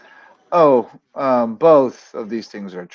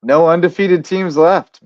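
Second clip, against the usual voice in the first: emphatic announcement; 5 semitones higher, 8 dB louder, slower than usual. Real, not acted. Announcing the big news.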